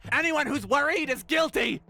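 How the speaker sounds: high-pitched hammed-up accusation; mock accusing. Acted accusation in Robespierre retelling.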